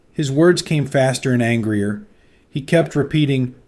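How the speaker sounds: plain reading voice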